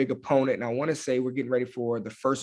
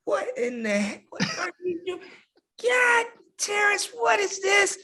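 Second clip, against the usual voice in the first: high, strained mock coach shout. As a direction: holler in exasperation across a room, pitched way up. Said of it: "You just hear Coach D coming from across the trailer".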